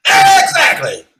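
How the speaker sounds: loud, high burst of agreement; excited shout. Real, not acted. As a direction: shout it out, thrilled he got it.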